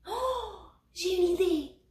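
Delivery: high, bright-pitched eureka; excited idea. A narrator's reading. Puppy finds a sled for the toys, excited. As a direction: exclaim with sudden inspiration.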